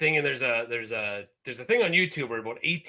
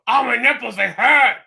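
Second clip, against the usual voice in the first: higher and much louder mock wail; whiny cartoon complaint; 7 semitones higher, 10 dB louder than usual. Cartoon character complaining about his nipples, mock wail. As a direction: ham up the cartoon's pain. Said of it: "when he's sitting there going".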